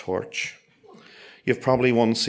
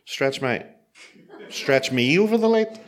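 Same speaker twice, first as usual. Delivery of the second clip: incredulous; 4 semitones higher than usual. Retold or imitated. Incredulous question at instruction.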